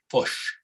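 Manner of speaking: "fush" with a short u sound for the i of fish; New Zealand vowel. Shown as a demonstration. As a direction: model "fush" the Kiwi way, rhyming with push. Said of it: "I want you to say fush".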